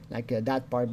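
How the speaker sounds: ordinary delivery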